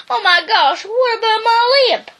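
timid dog fretting in retreat; panicky little dog. Acted. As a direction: whine about the limp. Drover voice panic.

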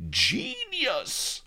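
high, loud cry of Genius; two friends crying out in wonder; excited cry. Acted. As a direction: cry it out in awe. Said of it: "we cried".

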